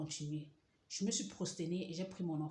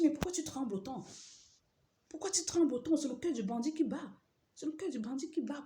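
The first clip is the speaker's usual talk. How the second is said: raised confronting voice; 8 semitones higher, 4 dB louder than usual. Retold or imitated. Confronting trembling husband.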